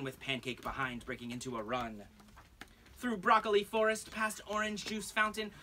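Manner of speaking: lilting rhymed read-aloud; singsong picture-book reading; storybook narration. Acted. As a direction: read it to a room of kids.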